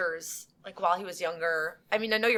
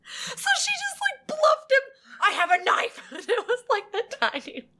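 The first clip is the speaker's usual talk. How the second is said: much higher, giggling through it; laughing squeal. Real, not acted. Laughing retelling of bluff.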